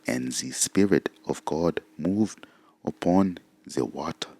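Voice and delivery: soft, slow mock German accent, th turned to z. Shown as a demonstration. Reading the Geneva Bible aloud as a German would, mock German accent. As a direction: read scripture like a hammed-up German preacher, slowly. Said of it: "the German accent".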